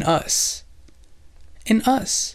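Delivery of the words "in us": "In us" lifted high for emphasis; higher-pitched, set-apart "In us"; emphatic repetition. Real, not acted. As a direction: repeat "In us" as the key point, pitched well up. Repeats the key point for emphasis.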